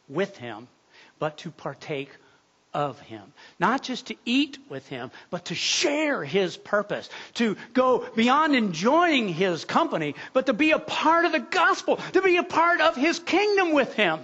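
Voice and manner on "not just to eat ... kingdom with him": rising preaching. Acted. Preacher urging the congregation toward the kingdom, building in fervor. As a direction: lift the room to the climax.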